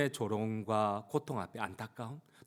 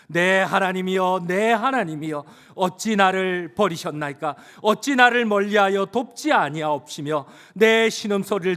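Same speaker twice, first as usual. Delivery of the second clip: loud, high, drawn-out reading; declaimed scripture; 6 semitones higher, 11 dB louder than usual. Acted. Preacher leading congregation in the psalm, declaiming. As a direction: proclaim the verse slowly at full voice.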